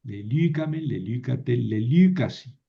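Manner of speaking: lelícame, lelícate, lelícasi stressed on the second syllable LÍ; shifted stress. Shown as a demonstration. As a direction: move the accent onto LÍ in lelícame, lelícate, lelícasi. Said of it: "¿Por qué moví el acento?".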